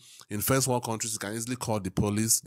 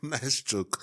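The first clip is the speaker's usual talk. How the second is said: talking through laughter; laughing reaction; amused; 3 semitones higher than usual. Real, not acted. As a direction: still chuckling as the words come out. Reacting to a dark joke.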